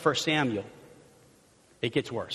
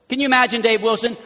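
ordinary talk, nothing marked

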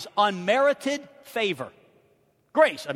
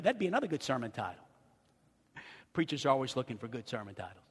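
ordinary talk

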